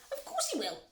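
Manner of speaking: high-pitched insistent screech. A narrator's reading. Mother arguing with factory owner, screeching. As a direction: screech back in contradiction.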